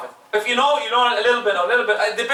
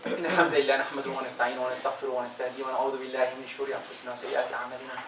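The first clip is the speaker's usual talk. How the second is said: quick, soft ritual Arabic recitation; khutbah formula recitation; 9 semitones lower, 14 dB quieter, much faster than usual. Acted. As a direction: rattle off the sermon formula under the breath. Recites closing and opening khutbah formulas.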